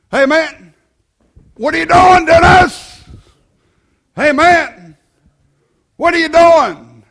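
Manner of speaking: preacher teasingly picking on a visitor; loud challenge. Real, not acted. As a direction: call him out by name. Preacher singling out congregants.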